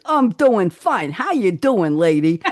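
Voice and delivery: drawn-out New York "lady" greeting; put-on New York accent. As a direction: imitate a hammed-up New York shop man. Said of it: "I've never talked like that".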